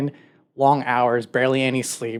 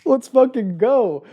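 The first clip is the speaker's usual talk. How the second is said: much higher, louder, pumped-up cheer; hyped celebration; 12 semitones higher, 4 dB louder than usual. Retold or imitated. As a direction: cheer like you just got set free. Hero celebrates not working anymore.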